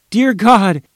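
high, loud cry of bewilderment; bewildered shout. A narrator's reading. Mother hearing her child whisper, shouting bewildered. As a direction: cry out in shock. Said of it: "the mother shouted, bewildered".

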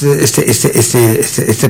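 stuck repeating este, este; stammering. Retold or imitated. Host stuck at the awards mic, stammering. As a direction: stammer on the filler like a lost host. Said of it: "ya estaba yo hasta tartamudeando".

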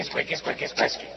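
whispered squeak of a sanitiser pump; squeaky pump noise. Retold or imitated. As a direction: mimic the squeaking dispenser.

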